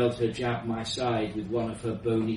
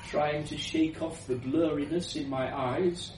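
usual speaking voice

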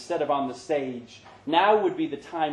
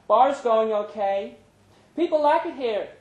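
proud of her bar; pleased Brenda; 9 semitones higher, 8 dB louder than usual. Acted. Brenda telling Larry the bar does well, high and proud.

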